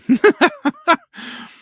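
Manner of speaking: loud, high burst of laughter; real laugh. Real, not acted. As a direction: laugh at the cats. Laughing at the cats.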